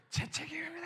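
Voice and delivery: high, anguished cry of the confession; retelling the pastor's anguished cry; wailing. Retold or imitated. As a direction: wail out the guilt. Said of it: "오열을 하시는 거예요".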